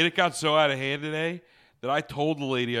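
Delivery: ordinary delivery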